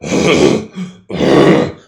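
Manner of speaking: loud, rough demonstration cough; abnormal cough. Shown as a demonstration. Instructor showing a cranial nerve problem, abnormal cough. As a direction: fake a problem cough, drawn out. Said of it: "but if they cough like".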